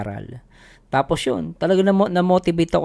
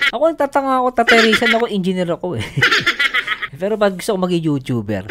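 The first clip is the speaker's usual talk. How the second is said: laughing; 6 semitones higher than usual. Real, not acted. Laughing at his own joke.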